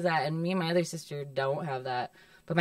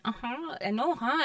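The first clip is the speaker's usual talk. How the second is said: put-on Midwest accent; 6 semitones higher, 4 dB quieter, slower than usual. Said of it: "Canadian accent a little bit in there".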